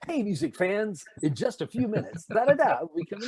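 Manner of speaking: high, bright announcer intro; concert announcer. Shown as a demonstration. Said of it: "it's like".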